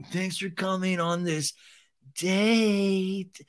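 mock creepy Schwartz, date drawn out; creepy imitation. Imitating Schwartz greeting his date, mock creepy. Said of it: "I'm even doing it creepy on the imitation".

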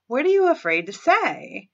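normal talking voice

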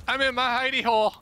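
fast, high squeaky voice; silly high voice. Pretend. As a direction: squeak it out like a little critter. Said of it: "I could be like".

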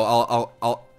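ordinary delivery

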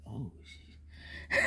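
whispered, hushed curse under breath; whispered oh shit. Retold or imitated. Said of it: "he like".

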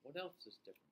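usual speaking voice

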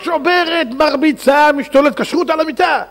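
high, frantic storytelling; mock frenzy. A narrator's reading. Preacher describing the possessed princess smashing and hitting, high mock frenzy. Narrating the daughter's wild rampage.

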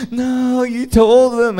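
high mock protest; imitated girlfriend's high-pitched objection.

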